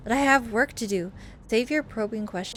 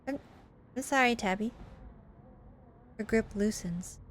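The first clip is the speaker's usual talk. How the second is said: ordinary talk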